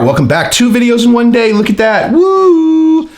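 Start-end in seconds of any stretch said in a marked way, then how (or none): 0.5-2.1 high-pitched, excited announcement; excited boast. Real, not acted. Vlogger hyping two uploads in one day, excited. 2.1-3.1 long, high-pitched "woo"; excited whoop. Real, not acted. Vlogger cheering two videos in one day, whooping.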